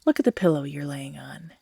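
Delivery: hushed, unvoiced menacing instruction; creepy whisper. Captor pointing out the pillow to the captive, creepy whisper. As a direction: whisper it like a gentle threat. Captor directs tied-up listener.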